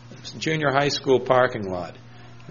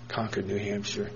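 much softer, lower reply; soft answer; 4 semitones lower, 11 dB quieter than usual. Retold or imitated. Young interviewer answering the old man, very soft. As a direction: say it gently, almost too quiet. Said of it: "okay, soft answer".

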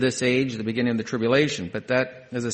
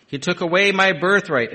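indignant Esau; 7 semitones higher, 8 dB louder than usual. Acted. Esau protests to his father.

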